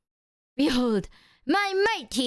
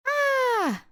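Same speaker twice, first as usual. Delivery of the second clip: mock scream; 13 semitones higher than usual. Mock attack by the cat.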